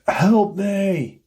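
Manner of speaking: high, stretched mock cry; retold scream for help. Unseen man screams right beside the searcher, retold scream for help. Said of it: "There's just a scream".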